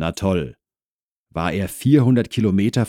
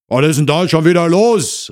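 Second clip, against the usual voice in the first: loud, raised shout from another room; called out; 10 semitones higher, 9 dB louder than usual. Acted. Husband calls from inside the flat, shouting. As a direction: holler it to whoever is at the door. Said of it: "rief eine Männerstimme aus dem Inneren".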